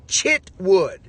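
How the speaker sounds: Chitwood with a clear, stressed ch; teaching the name. Shown as a demonstration. Teaching David on stage to say Chitwood, stressing ch. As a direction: model Chitwood slowly with ch. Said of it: "teach him on stage how to say Chitwood".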